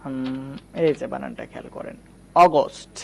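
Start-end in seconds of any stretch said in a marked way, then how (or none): 2.4-3.0 "অগস্ট" said crisply with a short opening "aw"; teacher's careful first take of "অগস্ট"; pronunciation demonstration. Shown as a demonstration. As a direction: model "অগস্ট" clearly, starting on "অ". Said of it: "আমি যদি বলি".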